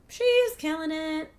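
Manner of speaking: high, slow, proud She's killing it; proud exclaim. Real, not acted.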